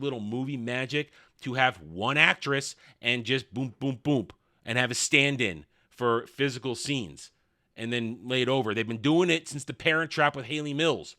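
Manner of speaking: usual speaking voice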